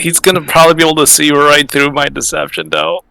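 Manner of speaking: high, loud, coming out of real laughter; amused dismay. Real, not acted. Player expects her lie to fail, amused dismay.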